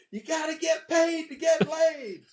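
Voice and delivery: high-pitched mock yell, drawn out; imitated girls yelling. Young girls yelling at a conference speaker, high mock yell. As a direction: chant it high like girls calling out. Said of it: "All these pretty young girls yelling".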